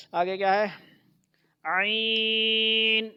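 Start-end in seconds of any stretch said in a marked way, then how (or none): 1.6-3.1 عین drawn out very slowly from the throat; slow throaty model of عین; model Ain. Shown as a demonstration. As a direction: stretch عین long without nasal ai. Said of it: "آئی کی آواز نہیں جانی چاہیے ناک میں".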